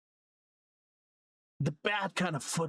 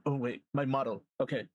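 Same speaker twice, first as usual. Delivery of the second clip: usual speaking voice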